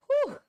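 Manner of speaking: loud high exhaled whew; tired whew. Real, not acted. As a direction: let out a big tired breath. Exhale between sections.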